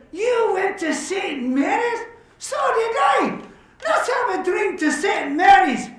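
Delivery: high-pitched, hammed-up astonishment; marvel, then burst into a toast; loud, exuberant joke drinker; amazed delight. Joke character amazed at shared school.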